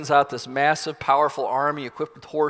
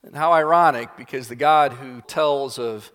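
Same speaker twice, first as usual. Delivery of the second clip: usual speaking voice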